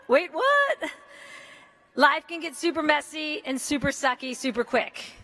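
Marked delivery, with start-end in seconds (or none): 0.1-0.9 surprised double take. Pretend. Mock double-take, second stronger.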